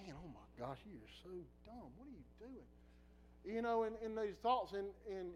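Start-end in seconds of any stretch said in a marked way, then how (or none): 0.0-3.5 inner scolding. Retold or imitated.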